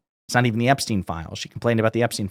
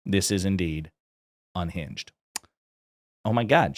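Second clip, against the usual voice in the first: normal talking voice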